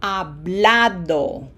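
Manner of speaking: hablado, slowly, with the stressed A of -ado drawn out. Shown as a demonstration. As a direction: lean on the middle syllable: ha-BLA-do. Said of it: "this syllable is the one that gets pronunciation emphasis".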